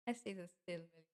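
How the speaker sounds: usual speaking voice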